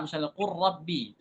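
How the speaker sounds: qur rabbi with the r merged and doubled; tajwid example. Shown as a demonstration. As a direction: say qur rabbi as qurrabbi, r held. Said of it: "Misalnya lam dengan ra".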